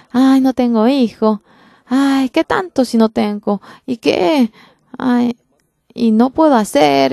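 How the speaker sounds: mild acted lament of a childless woman.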